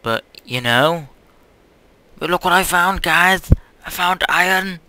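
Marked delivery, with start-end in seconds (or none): 2.2-4.8 high, loud, giddy exclamations; pitched up and bursting with excitement; excited. Real, not acted. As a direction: crow over a find, shouting it repeatedly. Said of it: "I must be so excited".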